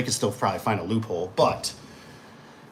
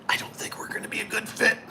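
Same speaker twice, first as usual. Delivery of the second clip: high, soft whisper; tactful refusal; 9 semitones higher, 8 dB quieter than usual. Shown as a demonstration. Contractor turning down a risky job, whispered refusal. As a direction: whisper the polite brush-off.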